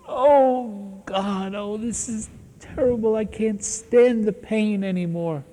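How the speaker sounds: high, anguished moan of a man in torment; moaning and wailing. Retold or imitated. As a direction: wail it like you can't bear the pain. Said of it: "And moaning and groaning and wailing".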